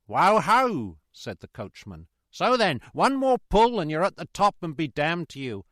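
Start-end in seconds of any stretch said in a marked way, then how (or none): 0.1-1.0 loud, high drawn-out halt call; coachman's call. A narrator's reading. Coachman to his horses, loud drawn-out call. 2.3-5.7 loud, high urging shout; exasperated coachman. A narrator's reading. Coachman urging horses uphill, loud and exasperated.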